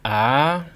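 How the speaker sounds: "Ah" sliding up; rising tone. Shown as a demonstration. Teacher demonstrating the rising tone, "Ah" climbing. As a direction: slide "Ah" up the musical scale. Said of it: "Slide up the musical scale".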